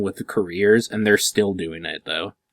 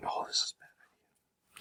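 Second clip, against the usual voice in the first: soft breathy whisper, slowed; 17 dB quieter, much slower than usual. Real, not acted. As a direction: whisper it under your breath. Groans at friend's awkward story.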